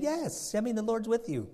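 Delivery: high, softened fake assent; put-on sweet sarcasm; sarcastic agreement. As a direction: humor the king insincerely. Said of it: "He saw the sarcasm".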